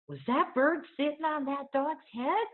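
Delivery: put-on high, wide-eyed small girl asking; high childlike wonder; little girl voice. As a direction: voice her as a curious five-year-old.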